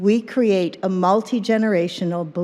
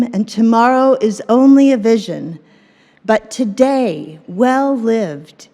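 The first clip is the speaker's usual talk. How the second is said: poem reading; 4 dB louder than usual. Acted. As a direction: read it like a sacred verse. Reads opening poem slowly.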